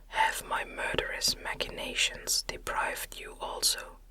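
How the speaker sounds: ordinary talk, nothing marked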